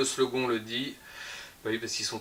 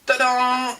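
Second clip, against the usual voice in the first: loud, high drawn-out ta-da; playful, high-pitched fanfare; mock fanfare; 9 semitones higher, 6 dB louder than usual. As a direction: announce it like a grand reveal. Mock reveal of a novelty.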